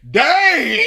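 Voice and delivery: high mock dismay, laughing; laughing exclamation. Fan recalling forgetting, laughing. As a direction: blurt it with a laugh. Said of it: "I was just like".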